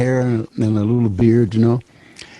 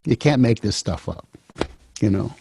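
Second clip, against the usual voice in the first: ordinary talk, nothing marked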